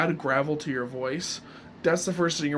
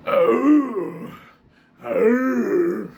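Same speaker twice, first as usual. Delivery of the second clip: loud, gravelly groan, pitched up; forced-out groan; 6 semitones higher, 8 dB louder than usual. Shown as a demonstration. Showing viewers how to force out gravel, rough groan. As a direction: push it out like a cough or burp. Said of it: "trying to force it out".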